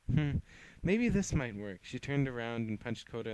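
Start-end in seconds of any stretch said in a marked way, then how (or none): 0.1-1.8 musing villain. Acted.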